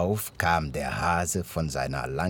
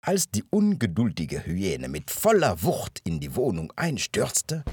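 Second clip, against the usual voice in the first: usual speaking voice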